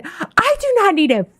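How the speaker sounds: high, mock furious yell; retold scream. Wife retelling how she screamed at her husband, high and furious. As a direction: fling it back like she did at him. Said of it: "screamed at him".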